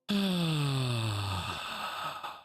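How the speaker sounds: a long drawn-out tired sigh; long weary sigh. Acted. Captain sighs at the teens, long weary sigh. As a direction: let out the longest weary sigh. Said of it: "the longest sigh".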